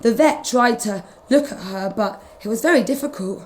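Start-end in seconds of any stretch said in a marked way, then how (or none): none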